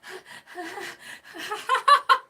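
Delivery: high, loud burst of laughter. Real, not acted. Co-host laughing at the ahead-of-the-curve joke, loud high laugh.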